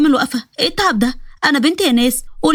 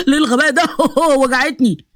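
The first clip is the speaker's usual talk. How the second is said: laughing in pain; 3 semitones higher than usual. Acted. Punched in the face, laughing.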